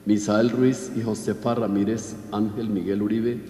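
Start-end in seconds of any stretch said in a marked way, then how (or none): none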